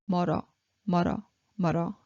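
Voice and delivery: fast 멀어 멀어; rapid repetition. Shown as a demonstration. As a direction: rattle off 멀어 quickly. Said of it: "rapid drill".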